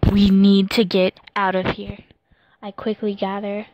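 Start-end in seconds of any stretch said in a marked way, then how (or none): 0.1-2.0 loud urgent yell. Acted. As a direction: call it out urgently. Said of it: "Yimo yelled out".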